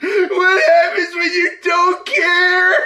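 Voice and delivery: high wailing mock sob; fake-cry the line. Imitating fake crying lyric.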